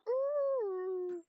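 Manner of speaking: high, loud dog-like whine; whining dog. Retold or imitated. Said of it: "you sound like a whining dog right now".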